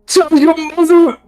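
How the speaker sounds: high, loud cry of love; desperate dying father. Acted. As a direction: call out to your daughter as you die. Dying father calls to daughter.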